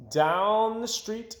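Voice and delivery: usual speaking voice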